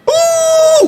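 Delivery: very high, loud mock wail; loud child crying. Child out-crying the mourners at a funeral, loud mock wail. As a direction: burst into an imitation child's howl. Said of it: "anak ibu lebih kuat lagi nangisnya".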